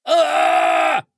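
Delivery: loud, high shriek; high squeal. Acted. Creature being chased, loud high squeal. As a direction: squeal in panic. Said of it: "ораш верещал".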